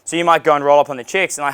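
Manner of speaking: ordinary talk, nothing marked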